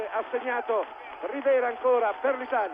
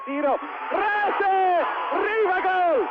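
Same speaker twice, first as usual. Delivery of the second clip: drawn-out goal shout, high and loud; breathless Italian goal commentary; 7 semitones higher, 4 dB louder, much slower than usual. Real, not acted. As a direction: yell the goal, stretch it out.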